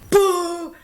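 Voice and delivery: boo the stage like the crowd did; mock boo. Shown as a demonstration.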